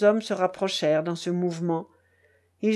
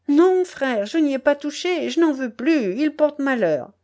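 high, raised-voice protest; anxious denial; 6 semitones higher, 4 dB louder than usual. A narrator's reading. Peasant swears he left the money alone, anxiously. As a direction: plead innocence urgently.

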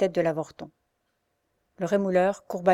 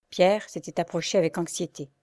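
plain reading voice

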